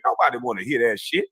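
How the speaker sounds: ordinary delivery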